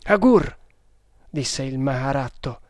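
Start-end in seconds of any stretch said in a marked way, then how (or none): 0.1-0.6 a higher, louder cry of dismay. A narrator's reading. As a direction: speak his name in shock.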